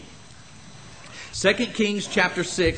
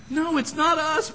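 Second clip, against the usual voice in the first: pleading; 13 semitones higher, 4 dB louder than usual. Retold or imitated. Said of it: "down on his knees going".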